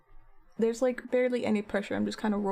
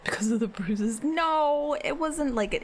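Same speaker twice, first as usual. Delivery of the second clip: usual speaking voice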